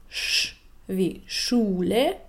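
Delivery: sch wie Schule, the sch drawn out slowly like in Schule; hissing sch. Shown as a demonstration. Teacher shows the sch sound, slowly. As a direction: hold the sch long and slow. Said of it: "eine Reihe von Zischlauten".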